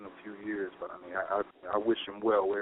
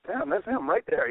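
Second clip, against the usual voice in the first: starstruck; 8 semitones higher than usual. Retold or imitated. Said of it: "I'm like".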